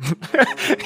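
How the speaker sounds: sharp high laugh. Real, not acted. Laughing at the mask honor system, high laugh. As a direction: laugh out at the absurdity.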